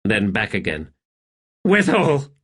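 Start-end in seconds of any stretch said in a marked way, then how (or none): 1.6-2.3 high, loud call of the name; shouted name. A narrator's reading. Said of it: "He stared at Withal".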